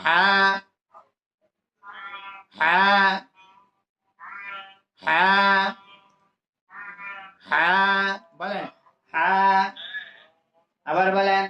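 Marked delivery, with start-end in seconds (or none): 0.0-7.7 "হা" with a breathy, constricted h, held; mid-throat ha. Shown as a demonstration. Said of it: "কণ্ঠনালীর মাঝখান থেকে উচ্চারণ". 9.1-9.3 "হা" with a breathy, constricted h, held; mid-throat ha. Shown as a demonstration. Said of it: "কণ্ঠনালীর মাঝখান থেকে উচ্চারণ".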